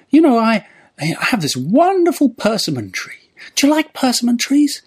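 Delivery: put-on high, sweet old man voice; little old man. Old man chatting to tramp about trees, high voice. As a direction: share it chattily in a falsetto. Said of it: "the old man starts talking about".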